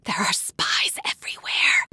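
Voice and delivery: hushed conspiratorial whisper. Acted. Scientist warning the stranger about spies, urgent whisper. Secretive NPC warns of spies.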